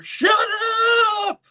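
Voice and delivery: drawn-out mock yell; exasperated shout, played for laughs; imitated yell. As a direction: yell it like an annoyed friend, stretching the end. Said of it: "yell in my ear".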